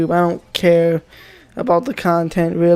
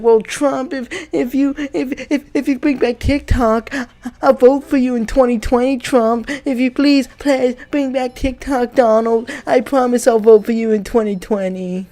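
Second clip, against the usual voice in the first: higher, begging whine to Trump; pleading kid; 5 semitones higher than usual. Retold or imitated. TikTok kid begging Trump to bring app back, higher whine. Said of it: "start bartering with Trump".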